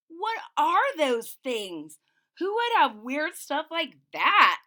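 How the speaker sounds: spooked. Acted. Kids spooked by strange crates.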